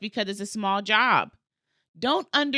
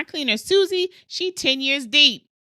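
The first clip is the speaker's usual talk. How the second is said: proud brag; 6 semitones higher, 6 dB louder than usual. Real, not acted. Bragging about her cleaner.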